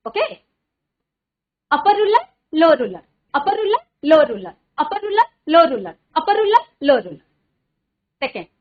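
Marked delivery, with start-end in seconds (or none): 1.7-7.3 speedy tongue twister. Shown as a demonstration. Teacher racing through it to show her class. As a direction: speed up with each repeat, keep it crisp. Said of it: "We have to speak speedy but accurately".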